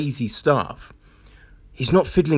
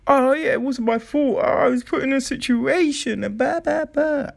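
high mocking whine of a pedo's excuse; 10 semitones higher than usual. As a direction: sneer it up high, mimicking. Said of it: "they say".

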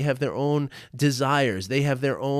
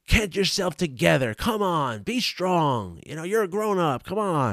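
higher-pitched mock scolding of his wife; retold exasperation; 7 semitones higher than usual. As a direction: retell an impatient pep talk, pitched up. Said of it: "I get angry because she's crying".

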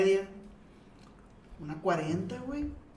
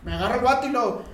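usual speaking voice

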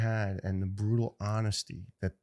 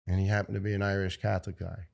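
usual speaking voice

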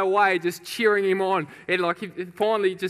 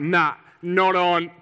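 slow, loud, drawn-out defiance; emphatic refusal; 5 dB louder, much slower than usual. Retold or imitated. Champion hears his record is beaten, slow emphatic refusal. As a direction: weigh each word slowly and firmly.

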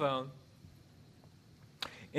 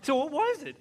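high, louder question; interrogating wife; 13 semitones higher, 6 dB louder than usual. Retold or imitated. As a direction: grill him. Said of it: "wife questioning".

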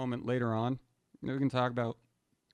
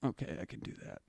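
soft, breathless okay; whispered compliance to the cops; whispered meek reply; 2 semitones lower, 11 dB quieter, faster than usual. Retold or imitated. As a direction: go along quietly, half-whispered. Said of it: "I was like".

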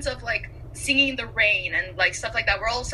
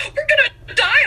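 high, loud cry of doom; hammed-up panic; mock panic shout; 13 semitones higher, 7 dB louder than usual. Acting out Anna panicking.

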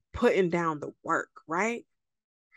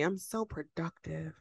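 slow, much softer breathy purr; sultry whisper; 9 dB quieter than usual. Shown as a demonstration. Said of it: "Say it with me".

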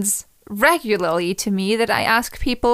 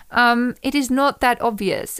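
ordinary delivery

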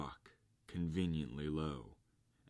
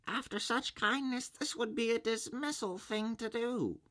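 very high squeaky little voices; high, panicky little oysters; tiny oyster voices; 3 dB louder than usual. A narrator's reading. Oysters plead.

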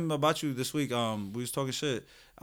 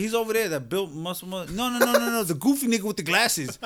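excited correction; 9 semitones higher, 4 dB louder than usual. Retold or imitated. Speaker's own quote, rising excitement.

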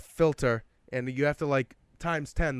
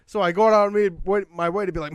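ordinary talk

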